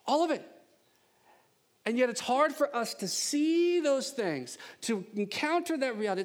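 high, emphatic sermon delivery; impassioned preaching. Real, not acted. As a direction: drive the point home, pitched high.

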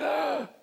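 sharp high loud gasp; mock gasp of sudden fear, high and loud; shocked gasp. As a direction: act out a startled intake of breath. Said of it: "and he freaks out".

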